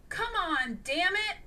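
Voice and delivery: exasperated curse at a stubborn machine; frustrated. A narrator's reading. Isaac cursing while hacking, frustrated. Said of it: "he said frustratedly".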